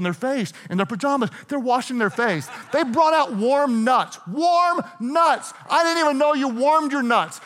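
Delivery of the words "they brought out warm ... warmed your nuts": excited. Real, not acted. Preacher marveling at first class perks, excited.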